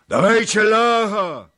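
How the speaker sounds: very high, loud, slow roar; menacing shout. A narrator's reading. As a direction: roar for the prisoner. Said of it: "wołali coraz groźniej kozacy".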